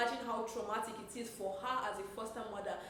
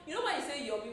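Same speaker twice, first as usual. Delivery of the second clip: ordinary talk, nothing marked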